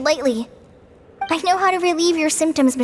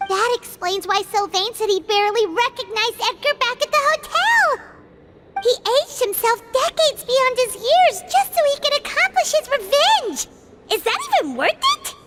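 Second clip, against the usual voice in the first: high, astonished outburst; shocked exclaiming; 7 semitones higher than usual. Real, not acted. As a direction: blurt it out in disbelief. Companion reacts to shocking revenge plot.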